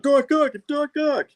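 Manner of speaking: very high mock fanboy cheer. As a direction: chant the name like a giddy fan. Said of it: "They're, like, fanboying over him".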